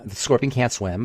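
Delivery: ordinary talk, nothing marked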